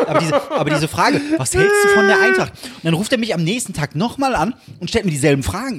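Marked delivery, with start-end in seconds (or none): 1.4-2.5 high, loud mocking quote; exasperated mock quoting. Son fed up with Dad's question, shrill-high exasperated mockery. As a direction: parrot the hated question, pitched way up.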